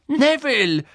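high, loud shout of a name; crying out. A narrator's reading. Ron shouting at Neville, an outraged cry. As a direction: cry out in alarm. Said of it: "schrie Ron auf".

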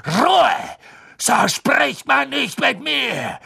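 high, loud, drawn-out shout; enraged teacher screaming; furious roar. A narrator's reading. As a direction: bellow for silence, then stretch the rebuke. Snape roars for silence.

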